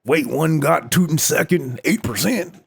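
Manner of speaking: loud, high, hammed-up Texas drawl; horrible Texas accent. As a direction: do a bad cartoonish Texan, incredulous and slow. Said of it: "That's my horrible Texas, uh, accent".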